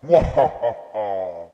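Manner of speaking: slow evil villain cackle; slow theatrical villain menace. Acted. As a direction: drag out a gloating evil laugh. Villain gloats after the two are gone.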